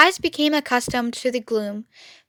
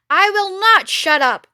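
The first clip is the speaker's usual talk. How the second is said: shrieking rat; 7 semitones higher than usual. Acted. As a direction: refuse shrilly, stress NOT. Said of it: "shrieked Rat".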